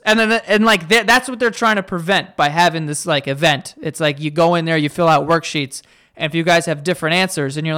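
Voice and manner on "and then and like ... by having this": ordinary talk, nothing marked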